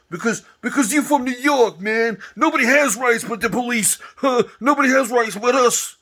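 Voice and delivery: mock New York cop. Mocking New York police as arrogant, hammed-up high voice. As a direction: sneer it like a cocky NYPD cop.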